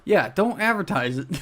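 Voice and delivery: ordinary delivery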